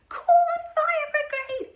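very high, drawn-out panicked screech; sudden shout. A narrator's reading. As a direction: scream for help at full volume. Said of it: "she shouted suddenly".

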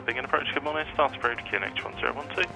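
ordinary delivery

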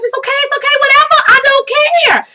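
very high, squealed reassurance; squeaky acted excitement; retold frantic eagerness. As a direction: blurt it high, desperate to accept. Retold eager reply to Oprah.

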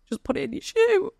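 urgent high-pitched repeat of the nurse's advice. Retold or imitated. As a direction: say it again way up high, pressing the point.

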